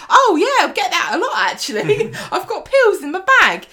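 loud, high-pitched caricature of a chatty widow; hammed-up, shrieky mock widow; brash character voice. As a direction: belt it out high and cheerfully oblivious. Lou's brash reply, acted in recap.

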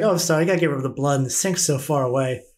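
very fast, casual excuse; rushed apology. Acted. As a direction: rattle it off breezily. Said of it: "she's like".

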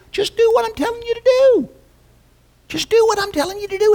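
high, fast, urgent insisting; emphatic insistence. Acted. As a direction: drive the point home, quick and high. Preacher voicing God's plain demand.

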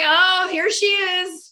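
slow, high, loud mock husband voice; husband's voice. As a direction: draw it out like her teasing husband. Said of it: "I could just hear him going".

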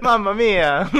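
high, loud cartoon Italian exclamation; hammed-up Italian plumber wail; Super Mario. Shown as a demonstration. Joking as Obama Super Mario, high loud Italian cry. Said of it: "doing Obama Super Mario".

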